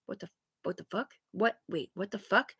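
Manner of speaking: very soft, baffled questioning; hushed disbelief. Retold or imitated. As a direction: imitate a proper woman cursing under her breath. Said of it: "she's just sitting there like".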